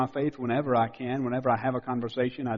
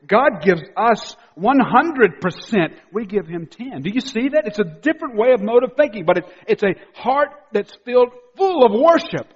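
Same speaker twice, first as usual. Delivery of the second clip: loud, high-pitched, driving sermon delivery; full-force preaching; 7 semitones higher, 8 dB louder than usual. Real, not acted. Preacher urging the congregation not to miss this, full force. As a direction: preach it loudly with raised pitch.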